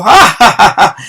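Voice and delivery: loud, high acted "ja, ja" laugh; mocking guffaw. A narrator's reading. Bruno laughing at the storytellers, mocking guffaw. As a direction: let out a scornful burst of laughter. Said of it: "aventó una carcajada y dijo".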